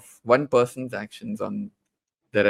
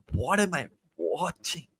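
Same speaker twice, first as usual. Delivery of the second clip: hushed, higher-pitched amazement; mock-stunned disbelief; 5 semitones higher, 7 dB quieter than usual. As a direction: softly replay a stunned reaction, pitch lifted. Retelling own stunned reaction to acting.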